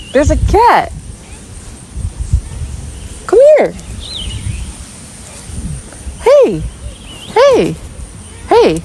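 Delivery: sing-song pet-calling voice; high coaxing call. Real, not acted. Calling the cat to come over, high and coaxing. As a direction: pitch way up and call out.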